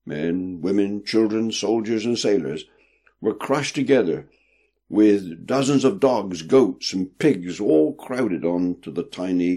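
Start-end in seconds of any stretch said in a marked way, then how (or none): none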